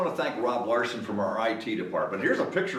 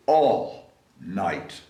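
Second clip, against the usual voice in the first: slow weary; 8 semitones lower, much slower than usual. A narrator's reading.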